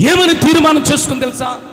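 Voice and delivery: loud, high rousing question; preaching. Real, not acted. Preacher rousing the congregation, loud. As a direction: fire up the congregation.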